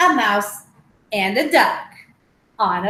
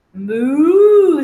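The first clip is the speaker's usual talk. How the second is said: cow moo; 3 semitones higher, 12 dB louder than usual. Acted. Cow answers duck.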